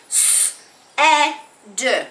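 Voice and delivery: S, e, d tapped out one by one; sounded out. Shown as a demonstration. Teacher segmenting said for the class.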